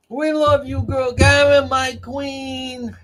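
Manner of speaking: put-on high, loud, drawn-out drunk cheer; mock a drunken fan wailing adoration; drunk hecklers imitated. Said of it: "The hagbag drunks from Quincy and the South Shore".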